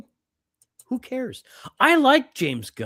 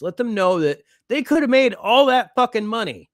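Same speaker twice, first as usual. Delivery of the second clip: loud rant; 10 semitones higher, 7 dB louder than usual. Real, not acted. Ranting at Warner Brothers.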